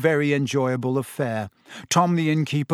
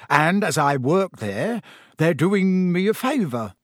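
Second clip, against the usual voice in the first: slow, studiedly offhand explanation; casual; 3 semitones higher than usual. A narrator's reading. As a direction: toss it off a little too carefully. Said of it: "His voice was casual".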